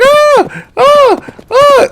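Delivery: high mock shrieks of panic; cousin's scream. Cousin on the speeding horse freaking out, high mock screams. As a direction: shriek like a scared kid on a runaway horse. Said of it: "and my cousin's like".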